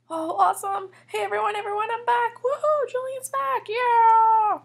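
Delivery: loud, high cheering that builds to a yell; excited announcement. Acted. Julian tells everyone he is back, excited announcement. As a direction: whoop it up for the whole room.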